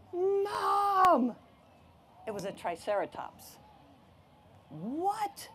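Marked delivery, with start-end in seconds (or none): 0.1-1.4 high, loud, drawn-out yell; excited call for Mom. Acted. Boy calling his mom about the gigantic dinosaur, excited yell. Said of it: "And he said". 4.7-5.5 high, rising squeak of disbelief; incredulous cry. Acted. Mom seeing the gigantic dinosaur, incredulous cry. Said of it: "cried my mom".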